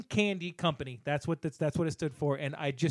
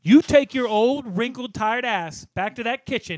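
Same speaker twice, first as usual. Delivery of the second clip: slow hammed-up bossy kid, louder at start; a touch of a bratty trick-or-treater; mock scolding; 5 semitones higher, 5 dB louder than usual. Kid berating old aunt over candy, mock scolding.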